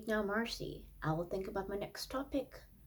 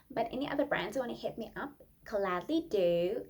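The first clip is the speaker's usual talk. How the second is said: usual speaking voice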